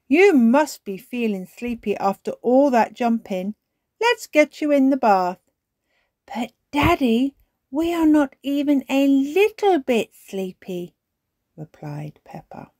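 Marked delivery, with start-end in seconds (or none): 0.1-5.4 higher, louder Daddy figure; calling out. A narrator's reading. Daddy Pig to Peppa and George at bathtime, calling out. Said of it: "called Daddy Pig". 6.2-10.9 put-on high, loud little-girl voice; protesting child. A narrator's reading. Child protesting to Daddy about being sleepy, insistent.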